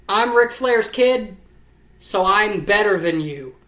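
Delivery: mocking Charlotte's character. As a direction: sneer it like a spoiled heir showing off. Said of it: "That is my character".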